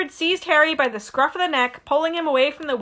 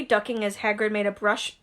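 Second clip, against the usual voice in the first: usual speaking voice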